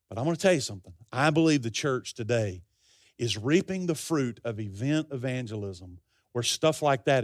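low-pitched, sober and heartfelt; earnest pastoral aside. Real, not acted. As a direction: drop your pitch and confide a burden. Pastor steps aside to confide a burden.

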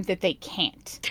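hushed, slow, almost breathless; whisper. Real, not acted. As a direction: drop to a sly whisper at the end. Smug aside about hogging bathroom.

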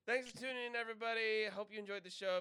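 upbeat show sign-off; host wrapping up the episode; podcast outro. Acted.

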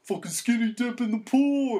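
high, loud party holler; rowdy cheer. Retold or imitated. Friends about to jump in the pool, rowdy holler. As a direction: whoop it out to rally a friend. Retold rallying cry before jumping.

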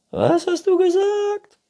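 very high, loud squeak; shouting squeaky raccoon; hard-of-hearing raccoon. A narrator's reading. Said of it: "Du musst lauter reden". (schwerhöriger Waschbär, hoch und laut)

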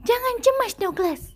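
little bird cheering Douglas up; chirpy bird. A narrator's reading. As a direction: squeak it like a lively little bird. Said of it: "cicit seekor burung yang lincah".

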